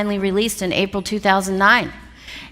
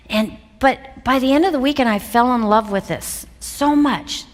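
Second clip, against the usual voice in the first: usual speaking voice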